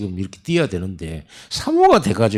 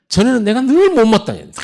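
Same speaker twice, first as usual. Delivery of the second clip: emphatic preaching; 14 semitones higher, 6 dB louder than usual. Acted. Confessing old discontent.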